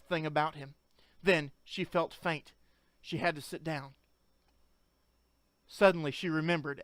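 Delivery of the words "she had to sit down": ordinary delivery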